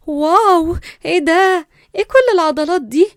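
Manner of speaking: high, loud gushing wonder; dazzled admiration. A narrator's reading. Girl dazzled by a muscular stranger, loud admiring gasps. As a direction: gush over him in amazement. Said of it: "الاتنين بصوا له بانبهار".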